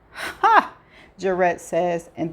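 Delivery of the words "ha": high, loud single bark of laughter; sudden acted one-syllable laugh; sharp "ha" laugh. A narrator's reading. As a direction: burst out with one amused "ha". Jarrett's burst of laughter at prank.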